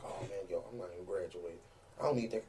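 very soft inner thought; muttering to himself. Retold or imitated. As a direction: mumble it to yourself, low.

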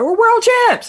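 very high, crowing mimicry; mock boasting. Mimicking Americans after winning a championship, mock boastful.

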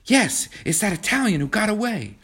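put-on high, eager girl's reply; breaking the news with high-pitched excitement; excited answer. A narrator's reading. Excited girl answers during air raid.

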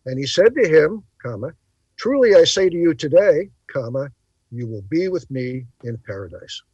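reading with comma named after today; phrasing the verse as I say to you today, comma, you will be; commas spoken. Shown as a demonstration. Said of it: "let me read it the way".

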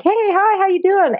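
high-pitched hello, as to an animal; retold friendly greeting. Woman greeting a captive dolphin at the pool, high friendly hello.

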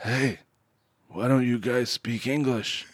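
soft, slow, laid-back delivery; Steven Seagal. Retold or imitated. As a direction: imitate an action star's understated line. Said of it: "and Seagal says".

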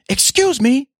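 very high mock snap; mock snapping at a man blocking a seat; imitated snap. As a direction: mimic her sharp high rebuke. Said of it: "she snapped at me".

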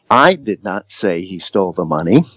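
emphasize "I" at the start; stress on I. Shown as a demonstration. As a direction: lean on the first word "I", rest lighter. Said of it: "the different emphasis".

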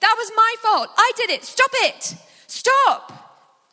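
high, loud begging to stop; desperate shout. Acted. Listener shouting at soldiers beating Jesus, loud and high. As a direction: scream at them to stop the beating. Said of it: "And you say".

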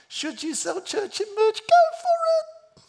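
very high squeaky encouragement; comic high-pitched go-ahead; exuberant approval. Real, not acted. Mock-exuberant approval of church merch.

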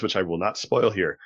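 ordinary talk

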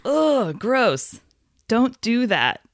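high, disgusted groan; annoyed ugh. Real, not acted. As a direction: recoil in disgust. Reacting to Kylo's creepiness.

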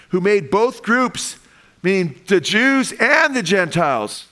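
fervent preaching. Acted. Pastor stirring the congregation about Gentiles, fervent preaching.

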